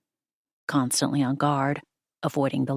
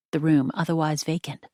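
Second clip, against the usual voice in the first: usual speaking voice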